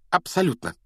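high-pitched, assured reply; confident. A narrator's reading. Hermione assuring Harry about the puzzle, confident. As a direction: answer firmly with a lifted pitch. Hermione sure of her answer.

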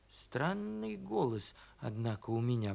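very soft musing to oneself; puzzled inner thought. A narrator's reading. Donkey wondering at his own voice, hushed. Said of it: "подумал он".

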